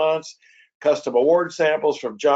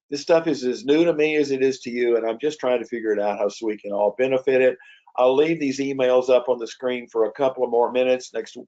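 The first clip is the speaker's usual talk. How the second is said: normal talking voice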